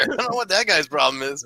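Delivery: ordinary talk, nothing marked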